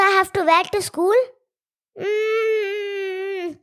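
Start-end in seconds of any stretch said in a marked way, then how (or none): 2.0-3.6 hurt little whimper through closed lips; sulky whine, near tears; whiny sulk. Acted. Daughter sulks, interrupted.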